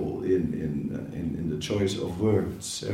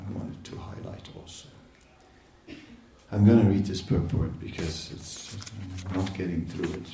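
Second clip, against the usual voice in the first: ordinary talk, nothing marked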